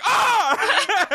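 high-pitched yelp of alarm; hammed-up scream; mock startled scream. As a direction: imitate a group shrieking in fright. Everyone screams at camper owner.

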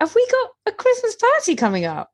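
very high, hammed-up question; mock surprise. Host feigning surprise at co-host's party announcement, mock surprise. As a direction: squeak it as fake astonishment. Hammed-up reply to staged segue.

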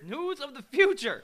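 loud, high mock-dramatic repeat; dramatic echo. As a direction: boom it like a trailer. Echoing dramatically.

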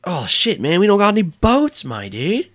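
high, casual bro dude; Todd's voice. Acted. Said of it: "I have to do Todd's voice".

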